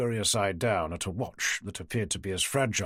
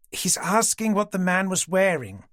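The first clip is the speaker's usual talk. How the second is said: louder, speaking up for a deaf friend; raised voice; 7 semitones higher, 4 dB louder than usual. Acted. Marjorie repeating the question louder for deaf Dorothy. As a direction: lift the voice so she hears. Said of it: "lifting her voice".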